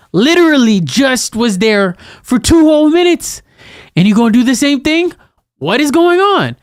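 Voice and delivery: put-on high, loud exasperated scolding of a kid; exasperated scolding parent.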